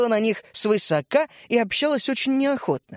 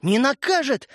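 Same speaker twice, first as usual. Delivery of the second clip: higher, fervent protest; heated exclamation; 6 semitones higher than usual. A narrator's reading. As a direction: burst out in hot denial. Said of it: "горячо воскликнул Светлик". (горячо, с жаром)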